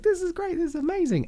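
squealing with delight at a one-day gig; gushing. Retold or imitated. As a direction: gush like a thrilled day player. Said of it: "and go like".

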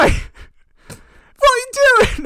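loud, high mock shout; anime dub voice. Imitating the English dub of an anime, loud high shout. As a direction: yelp it like an overdone dubbed cartoon. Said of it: "you listen with the, uh, dubbing".

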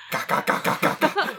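high, voiceless rapid caws; comic run of squawks; crow-like squawking. Retold or imitated. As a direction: mimic a budgie gone crow. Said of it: "两只都在嘎嘎嘎嘎嘎".

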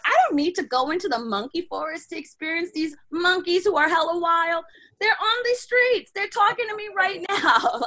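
high-pitched, incredulous complaint; exasperated protest. Retold or imitated. Acted protest about wild monkeys.

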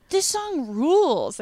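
high, drawn-out imitation of thrilled teen; hammed-up wonder, stretched out slowly; mock teenage awe. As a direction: gush like an amazed teenager. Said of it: "such a teenage thing".

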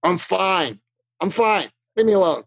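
lightly voiced sulky teen brushing you off; a hint of a shut-down teenager, curt; sullen teenager. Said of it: "a sullen, shut down teenager".

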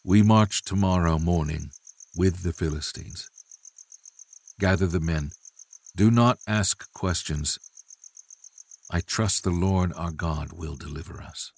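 soft, grave command; measured orders with quiet resolve; somber yet resolute. Acted. David ordering his men to march, somber yet resolute. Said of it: "With a somber yet resolute tone".

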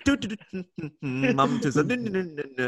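sing-song humming while kicking a seat; mock humming. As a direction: hum along idly like a bored kid. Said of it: "Costa was just being like Bender from Futurama".

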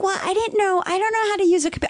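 high, fast, flustered mock aunt; aunt's excuse. Niece mimicking her aunt's excuses, high and hurried. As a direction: rattle off a lame excuse in a silly high voice. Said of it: "And she was like".

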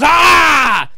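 loud, high battle yell; mock war cry, high and loud; fired-up yell. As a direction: imitate a wrestler roaring back to life. Retold wrestler's fired-up yell.

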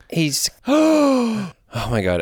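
gasping, slow breathless excitement; hyperventilating. Real, not acted. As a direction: gasp for air, overwhelmed. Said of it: "I'm hyperventilating".

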